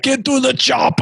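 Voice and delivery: movie action hero shout. Acted. Host telling dads to handle their kids, action hero shout. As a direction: bellow it like a movie strongman. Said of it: "That's pretty good".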